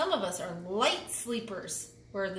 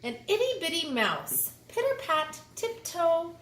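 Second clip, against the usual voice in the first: high bouncy storybook voice; light and dainty; 6 semitones higher than usual. A narrator's reading. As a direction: tiptoe the words up high.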